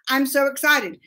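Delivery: usual speaking voice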